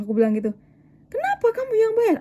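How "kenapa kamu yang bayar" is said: high-pitched mock telling-off; imitated scolding. Older woman scolds her for paying, imitated well-meant telling-off. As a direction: scold in a much higher voice, playing it up. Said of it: "Aku dimarahin, teman-teman".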